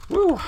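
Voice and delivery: short, high "woo" to catch breath; winded whoop. Real, not acted. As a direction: blow off the effort with a high woo. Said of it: "get my breath back".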